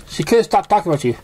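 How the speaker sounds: high, quick tomboy agreement; Rainbow Dash. A narrator's reading. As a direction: toss it off sporty and bold. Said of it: "agreed Rainbow Dash".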